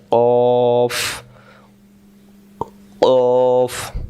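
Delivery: কফ from the back of the tongue, heavy and rounded; কফ with a thick, rounded back sound; deep back-tongue q. Shown as a demonstration. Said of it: "দেখেন".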